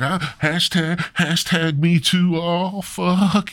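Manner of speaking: high-pitched comic panic; mock paranoid. Adam flees to hide in cave, high and paranoid. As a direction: stammer into a hammed-up panicked wail. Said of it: "he was paranoid".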